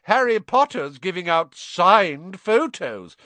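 loud, high, drawn-out announcement; mocking roar. A narrator's reading. Malfoy announcing to the crowd, loud mocking call. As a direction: call it out slowly to mock him. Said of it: "Malfoy roared to the crowd".

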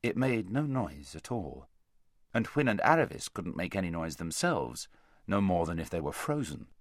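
usual speaking voice

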